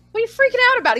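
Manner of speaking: high-pitched mock disbelief; lightly acted, incredulous high question; baffled retort. Retelling own baffled retort to the director.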